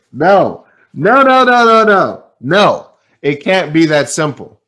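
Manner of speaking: frustrated newcomer shouting no at the executive; loud disbelieving no. Retold or imitated. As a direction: refuse to accept it, loudly. Incredulous refusal of simple advice.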